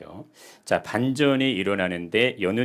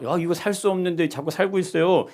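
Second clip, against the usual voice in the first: mock doctor; 9 semitones higher, 3 dB louder than usual. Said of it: "이렇게 얘기를 한".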